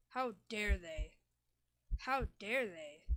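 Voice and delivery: loud, slow, furious repeat; slow angry seething. Acted. Dying mouse raging at the mice, slow furious demand. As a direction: let the rage build through the repeat.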